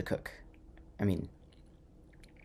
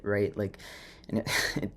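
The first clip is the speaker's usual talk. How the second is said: normal talking voice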